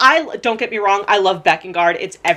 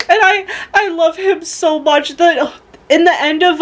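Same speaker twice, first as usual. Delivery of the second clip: tearful laugh-cry; 7 semitones higher than usual. Real, not acted. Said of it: "I'm gonna start crying".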